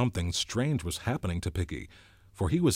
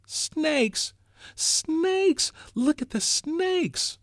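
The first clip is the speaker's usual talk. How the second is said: put-on very high, louder screaming child; terrified scream; 5 dB louder, much slower than usual. A narrator's reading. Small boy screaming at the crowd, terrified and very high. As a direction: shriek in terror, slow on the last. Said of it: "The little boy screamed at them".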